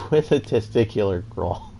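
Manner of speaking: words broken up by giggles; laughing. Real, not acted. Commentator recaps the testicular claw win, laughing. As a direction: try to report it while cracking up. Amused recap of winning hold.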